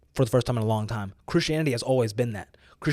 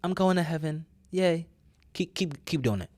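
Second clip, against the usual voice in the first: higher, smug mock-cheerful voice; 4 semitones higher than usual. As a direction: mimic a lazy, self-satisfied believer. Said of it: "you can go on just saying".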